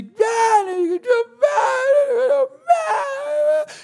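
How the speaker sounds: very high squealed mock tantrum; piercing child-voice yelling impression; screaming child. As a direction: imitate a little boy shrieking in anger. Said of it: "and they're screaming".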